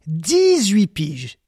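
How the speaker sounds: dix-huit piges, very slow and heavy; slow emphasis. Real, not acted. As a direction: drag the words out for effect. Drawn-out emphasis. (lent et appuyé)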